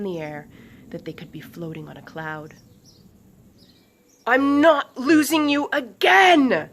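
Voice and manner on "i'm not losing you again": desperate, slow, loud cry; yell. A narrator's reading. Swift yelling to Lucas below her. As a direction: hold on and yell it out. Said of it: "Swift yelled".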